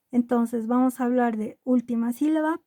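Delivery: ordinary talk, nothing marked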